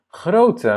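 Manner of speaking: high, loud Grote with a rising end; Dutch word demo. Shown as a demonstration. Teacher introduces Dutch word to class, high and loud. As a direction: call out Grote high and bright.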